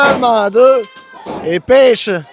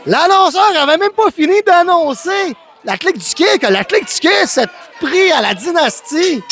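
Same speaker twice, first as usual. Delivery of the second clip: excited shout; 5 semitones higher than usual. Real, not acted. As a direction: shout over the chaos. Attack during the introductions.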